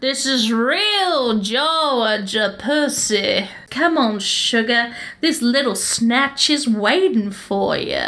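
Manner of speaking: put-on slow, drawn-out Southern belle accent; hammed-up Southern seductress accent, stretching the words. Ex-wife coaxing her lover, slow seductive Southern accent. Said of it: "read what she says in your accent".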